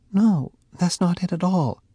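lightly voiced young woman, denying; Faye, hurt girlfriend. A narrator's reading. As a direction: brush it off but sound wounded.

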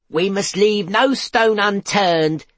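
slow, loud, determined pronouncement; rally everyone, slow and firm; stern hotel owner. A narrator's reading. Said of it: "said Mister Bunn".

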